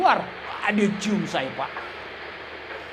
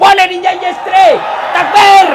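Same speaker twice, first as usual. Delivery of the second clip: loud, much higher call to the crowd; rallying shout; 8 semitones higher, 10 dB louder than usual. Real, not acted. Preacher calls the crowd to shout takbir, a loud rallying cry. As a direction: rouse the audience with one word.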